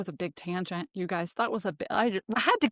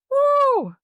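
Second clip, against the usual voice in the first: loud, high release whoop; much higher, louder woo to let it go; 7 dB louder than usual. Real, not acted. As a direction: shake the tension off with a whoop. Shakes off the rant.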